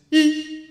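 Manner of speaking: high, loud sustained "ee" squeal; mock laugh like a ship's horn; mum's one-note laugh. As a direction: imitate a laugh as one piercing beep. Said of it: "her voice, it's extraordinary".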